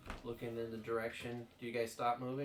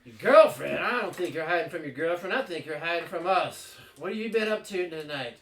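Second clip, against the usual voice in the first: loud, probing guard voice; suspicious guard; 5 semitones higher, 11 dB louder than usual. Acted. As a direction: interrogate him with suspicion.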